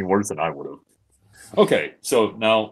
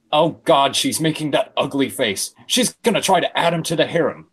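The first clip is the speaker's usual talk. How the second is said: exasperated higher voice; groaning character; 6 semitones higher, 4 dB louder than usual. Acted. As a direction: complain with disgust. Gaming groans in dialogue.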